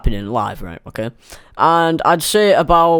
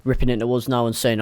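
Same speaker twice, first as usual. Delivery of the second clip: ordinary talk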